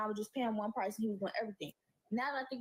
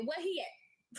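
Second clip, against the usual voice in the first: mom's angry demand; 5 semitones higher, much slower than usual. Retold or imitated. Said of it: "She said".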